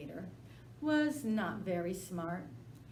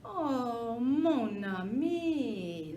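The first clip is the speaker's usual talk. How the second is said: slow, high crying whine; dog whimpering to the alligator; whining plea; 5 semitones higher, 7 dB louder, much slower than usual. Acted. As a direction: beg with a drawn-out whimper. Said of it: "that sly dog whined".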